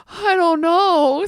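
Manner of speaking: high, drawn-out pitiful moan; sick husband's reply. Retold or imitated. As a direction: imitate a helpless, miserable husband. Voices the sick, dazed husband.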